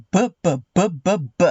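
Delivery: "B, b, b" as short repeated "buh" sounds; letter B sound. Shown as a demonstration. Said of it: "the letter B sound".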